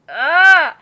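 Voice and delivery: loud, high cry of embarrassment; exasperated groan. Real, not acted. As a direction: let out a big frustrated "ah". Exasperated at own old lie.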